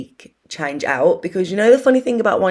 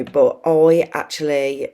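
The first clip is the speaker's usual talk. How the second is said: ordinary delivery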